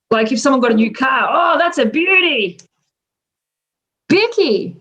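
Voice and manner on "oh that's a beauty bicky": higher, admiring Aussie exclamation; broad Australian admiration of someone's new car; more Australian. Acted. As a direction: lay on the ocker accent, impressed. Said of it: "if we wanna sound more Australian".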